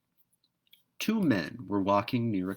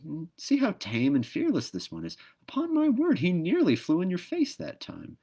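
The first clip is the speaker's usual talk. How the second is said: rising, higher astonished tone; amused surprise; 10 semitones higher, 4 dB louder than usual. A narrator's reading. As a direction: marvel at the fearless little bird. Jack marvels at bird.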